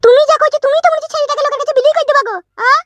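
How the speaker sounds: high, accusing woman's voice; a wife scolding her husband, high voice; accusing wife's voice. Acted. (উঁচু গলায় অভিযোগ করা বউ)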